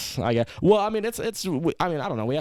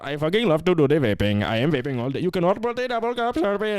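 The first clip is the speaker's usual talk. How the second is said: put-on Indian accent; 7 semitones higher than usual. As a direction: play it up in a thick Indian accent. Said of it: "Are we allowed to do Indian accents?".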